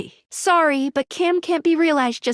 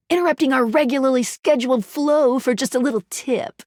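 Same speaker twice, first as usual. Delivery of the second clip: ordinary delivery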